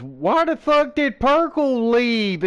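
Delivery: lightly voiced coworker, stretching each word out; imitating her question. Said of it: "is literally what she said".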